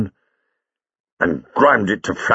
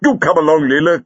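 raised, higher-pitched call; brisk summons, louder and higher; 6 semitones higher, 5 dB louder than usual. A narrator's reading. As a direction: call to someone lagging behind. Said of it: "called the doctor".

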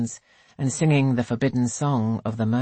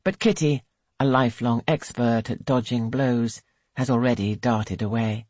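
ordinary delivery, nothing marked

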